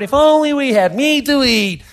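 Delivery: hammed-up, high-pitched complaint; mock wailing. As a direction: wail it like a whining crowd. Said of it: "when you wailed".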